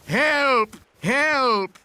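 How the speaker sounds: high urgent cry for help; calling for help. Shown as a demonstration. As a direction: call out help for the parrot to copy. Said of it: "I can teach Polly what to say".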